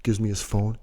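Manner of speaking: normal talking voice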